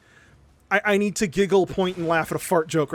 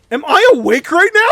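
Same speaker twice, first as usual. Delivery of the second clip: incredulous; 9 semitones higher, 10 dB louder than usual. Retold or imitated. Disbelief at the fat cardiologist.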